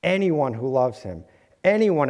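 ordinary talk

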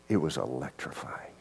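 hushed, breathless whisper. Real, not acted. Pastor recalling his freedom, whispering in awe. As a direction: let the awe drop to a whisper. Awed confession in sermon.

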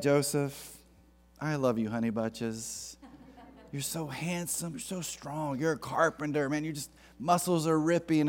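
soft, cooing flattery to a fiancé; butter him up softly, all sugar; Mary softly sweet-talking Joseph before the news; mock sweet-talk.

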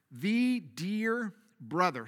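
very slow, lingering dear brother; savoring the phrase. Acted. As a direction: dwell on each word of the phrase. Re-reads the phrase for emphasis.

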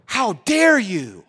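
high, loud, very slow mock outrage; five-year-old son accusing his dad, hammed-up outrage; kid's outraged catchphrase. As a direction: ham up a five-year-old's indignation. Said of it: "this is his new catchphrase".

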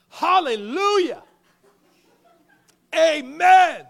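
loud, high, joyful shout; kid yells out. Retold or imitated. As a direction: yell it out across the park like an excited kid. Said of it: "even louder, the kid yells out".

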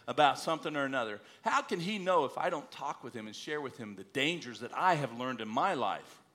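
normal talking voice

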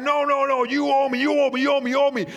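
high, loud, hammering you owe me; relentless angry chant of the debt; furious refusal. Acted. Servant refusing his fellow servant's plea, furious repeated shouting.

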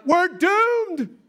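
high, loud, drawn-out wail; panicked yell. Acted. Servant surrounded by an army, yelling in panic. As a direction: howl it with mock despair. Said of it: "and he yells".

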